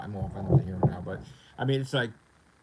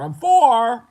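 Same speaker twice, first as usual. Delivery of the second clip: high, loud punchline; loud boast; 12 semitones higher, 14 dB louder than usual. Real, not acted. Gamer announcing his climb up the rankings, loud boast. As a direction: burst out suddenly loud and high.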